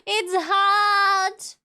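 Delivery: loud, drawn-out mock wail; hammed-up heat complaint. Streamer complaining to viewers about Southern heat, hammed-up wail. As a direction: wail it, stretching the last word.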